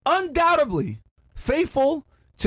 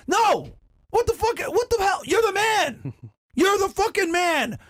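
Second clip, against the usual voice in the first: outraged shout; 9 semitones higher than usual. Real, not acted. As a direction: blow up at them, fired up. Ranting at men who negotiate.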